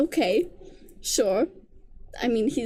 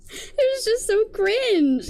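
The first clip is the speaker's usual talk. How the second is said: drawn out slowly in disgust; cringing drawl; 8 semitones higher, 3 dB louder, much slower than usual. Real, not acted. Reviewer drawling her disgust at the serenade. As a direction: stretch it out, wincing.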